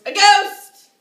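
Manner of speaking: sudden dramatic shout; alarmed exclamation. Acted. Storyteller announcing a ghost sighting, alarmed shout. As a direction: burst out loud on the sighting.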